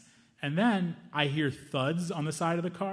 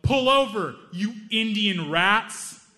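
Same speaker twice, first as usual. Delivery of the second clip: loud, higher yelled threat, slow at the end; yelling; 6 semitones higher, 6 dB louder, much slower than usual. Retold or imitated. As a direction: bellow it from a car window. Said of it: "he yelled".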